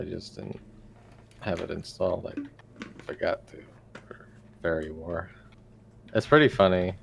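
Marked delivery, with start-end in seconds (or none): 0.0-5.3 whispered aside; whispering. Real, not acted. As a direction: breathe it, nearly voiceless. Aside mumbled off mic.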